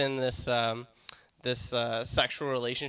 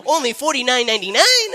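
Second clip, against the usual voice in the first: much higher and louder, pitchman squeal; hammed-up bargain hunter; friend's silly voice; 11 semitones higher, 13 dB louder than usual. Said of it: "That's how my friend talks".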